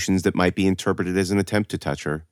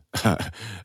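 soft chuckle; 6 dB quieter than usual. A narrator's reading. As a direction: give a small, quiet chuckle. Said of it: "Mister Toll chuckled".

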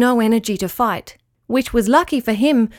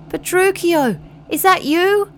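calling out; 8 semitones higher than usual. Acted. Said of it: "he called".

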